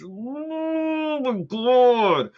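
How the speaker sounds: long, high, drawn-out wail; overwhelmed disbelief. Real, not acted. Could not believe this was happening, drawn-out disbelief. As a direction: stretch it way out, high and incredulous.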